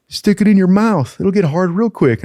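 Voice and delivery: teasing instructor. Retold or imitated. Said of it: "He's like".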